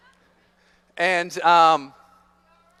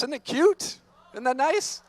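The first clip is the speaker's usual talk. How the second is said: cooing; 6 semitones higher, slower than usual. Acted. Cooing at caterpillar video.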